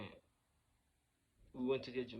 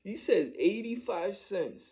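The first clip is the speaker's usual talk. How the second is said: "eighty-five cents" drawn out, high and loud; emphatic amazement; 8 semitones higher, 12 dB louder, slower than usual. Real, not acted. Storyteller amazed by the exact amount asked, "eighty-five cents" loud and slow.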